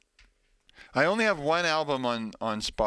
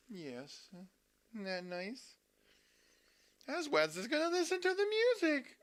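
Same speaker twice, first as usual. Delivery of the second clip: pet talk; 11 semitones higher, 7 dB quieter than usual. Real, not acted. Cooing to the pet.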